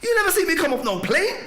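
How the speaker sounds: high, astonished thought; baffled inner voice. Retold or imitated. In his head, amazed the girl knows he is English, high and baffled. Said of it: "I'm thinking in my head".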